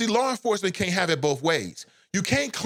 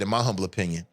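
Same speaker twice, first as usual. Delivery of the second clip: normal talking voice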